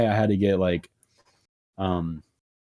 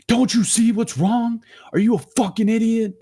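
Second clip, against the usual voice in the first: angry mom; 12 semitones higher than usual. Retold or imitated. Mom yelling at the school nurse, furious. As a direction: tear into the nurse like an outraged mom.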